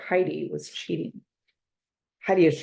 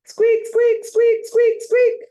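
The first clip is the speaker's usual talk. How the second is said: much higher squeaky hamster squeaks; 8 dB louder, slower than usual. Acted. The hamster squeaks at the frog next door, much higher. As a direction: squeak like a frantic little hamster. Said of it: "the more Humphrey squeaks".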